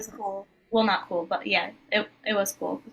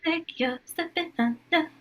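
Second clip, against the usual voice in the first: breathy voice; 9 semitones higher than usual. Shown as a demonstration. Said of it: "That's pretty aspirate".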